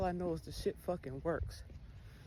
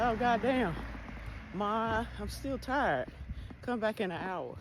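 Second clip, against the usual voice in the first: higher, louder mock whine to Mom; put-on complaint at being woken, easing off at the end; protesting sleepy child; 6 semitones higher, 3 dB louder than usual. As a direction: act out a kid begging for more sleep. Acted sleepy child answering mother.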